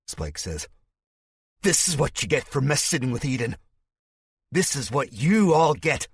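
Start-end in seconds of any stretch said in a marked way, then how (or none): 1.6-6.1 high, building loud tirade; a madman rising to a shout; unhinged rant. Acted. Spike raging at the crowd and captives, building high shout. Sanity has snapped.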